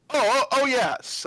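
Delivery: high, loud, startled stammer; flustered bookkeeper. Acted. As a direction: stumble out a sudden remembering. Bookkeeper flustered by payment demand.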